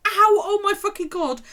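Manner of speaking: high, loud pained yell; loud high ow oh my God; retold scream. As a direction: shriek it out in pain. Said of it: "alternatively screaming".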